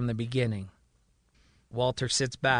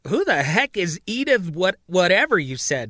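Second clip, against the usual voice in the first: demanding; 5 semitones higher, 4 dB louder than usual. A narrator's reading. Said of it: "Marion demands".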